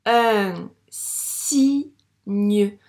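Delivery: "signe" loud, drawn out as si, gneu; syllable by syllable. Shown as a demonstration. Teacher correcting reading, "signe" loudly sounded out. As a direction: sound out "signe" in separate stretched pieces. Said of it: "elles forment le son gne".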